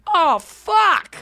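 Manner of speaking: a high, touched little outburst; overwhelmed oh. Retold or imitated. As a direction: blurt it out, caught off guard by sweetness. Moved by a sweet moment.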